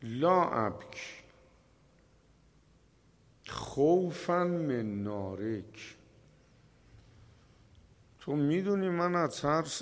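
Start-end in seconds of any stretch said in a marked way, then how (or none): 0.0-6.0 quoted Arabic. Acted. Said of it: "گفتش که".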